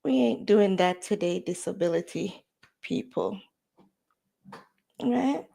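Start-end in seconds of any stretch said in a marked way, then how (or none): none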